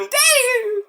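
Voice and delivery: put-on high child cry of daddy; startled child. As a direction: yelp it like a little kid. Kid sees bloody dad.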